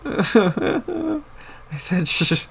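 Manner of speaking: laughing. Real, not acted. Repeats hush through laughter.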